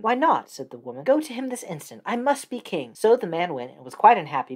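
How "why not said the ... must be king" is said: lightly raised, nagging wife; a pushy wife pressing her husband; wife's voice. Acted. Wife orders him.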